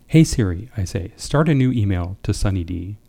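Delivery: normal talking voice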